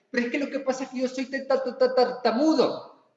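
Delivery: hammed-up stutter; stuttering Moses. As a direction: stutter out the excuse. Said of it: "porque era tartamudo".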